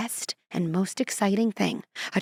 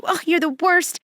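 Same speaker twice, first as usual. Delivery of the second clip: mock complaint; 9 semitones higher, 5 dB louder than usual. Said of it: "give my pilot a bad review".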